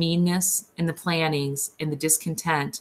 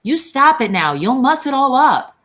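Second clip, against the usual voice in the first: high, loud angry cry; cried angrily; 9 semitones higher, 7 dB louder than usual. A narrator's reading. Curly's wife angrily orders Lennie to stop, a loud high cry. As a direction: snap at him to stop, higher and louder. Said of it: "she cried angrily".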